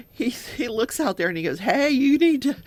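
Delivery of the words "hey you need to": higher, louder, laughing brag; a light take on a smug suspect mocking troopers; cocky boasting suspect. Suspect taunting officers about outrunning them, mildly mimicked swagger. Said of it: "he's laughing like it was some big joke".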